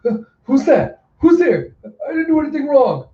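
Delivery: high, panicky startled yelps; frightened and defensive, squeaking high. Acted. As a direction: jump in alarm, pitch way up. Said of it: "he looks around surprised".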